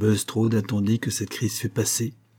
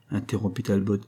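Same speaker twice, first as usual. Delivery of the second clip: ordinary delivery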